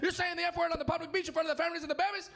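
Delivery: put-on high, fast rant in a Mexican accent; yelling Mexican guy. Comic as outraged local on a public beach, yelled in Mexican accent. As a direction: rattle off a high-pitched mock Mexican scolding. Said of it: "this Mexican guy starts yelling at me".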